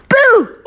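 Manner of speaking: sudden high, loud ghost boo; startling boo. Pretend. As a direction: pop out with a playful BOO. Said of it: "start with a ghost".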